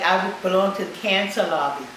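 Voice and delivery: ordinary delivery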